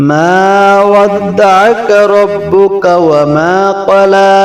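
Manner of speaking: high, melodic chanted recitation of the surah; Quran recitation. Acted.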